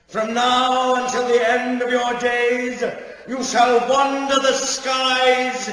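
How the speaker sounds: loud, high, booming decree; pronouncing the curse. Acted. Elder casting Lady Signa out, booming proclamation. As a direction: proclaim the sentence to the whole hall.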